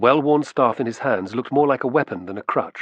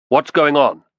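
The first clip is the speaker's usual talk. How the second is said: loud, higher voice of command; commanding question; 4 semitones higher, 7 dB louder, slower than usual. Acted. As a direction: demand an answer at once. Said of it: "tone of command".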